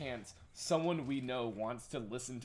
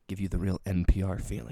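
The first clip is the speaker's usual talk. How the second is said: low, close-mic radio announcer; NPR radio voice; 7 semitones lower, 7 dB louder, faster than usual. Pretend. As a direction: lean into the mic like public radio. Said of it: "I'm gonna lean into my new mic".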